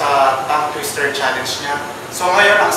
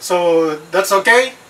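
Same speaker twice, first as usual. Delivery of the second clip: ordinary talk, nothing marked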